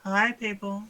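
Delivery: high, loud cheery hello; excited greeting. Real, not acted. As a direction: sing out a bright welcome. Greeting stream viewers.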